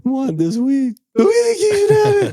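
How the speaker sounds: put-on a small boy fighting back tears; choked-up child. Retelling the son's gift to Mr. Cummings, imitating a tearful child. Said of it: "trying not to cry".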